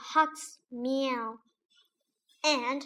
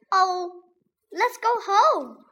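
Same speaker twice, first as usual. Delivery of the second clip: frightened plea; 6 semitones higher, 7 dB louder than usual. A narrator's reading. Scared boy begs to leave.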